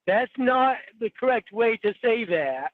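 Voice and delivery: hammed-up teacher scolding grammar; mock pedantic correction.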